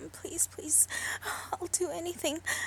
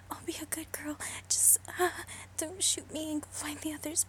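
ordinary delivery

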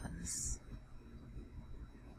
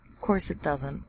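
normal talking voice

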